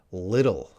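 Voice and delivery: little with a D-like middle; American flap T. Shown as a demonstration. Teacher repeating the requested word, American flap T. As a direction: say "little" as "liddle". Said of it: "might sound like a D sound".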